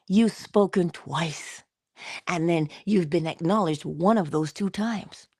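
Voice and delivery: ordinary talk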